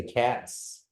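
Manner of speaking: cats with a crisp T-S at the end. Shown as a demonstration. Teacher has learners notice the sound, TS in cats. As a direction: feel the TS closing cats. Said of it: "You just feel that T S".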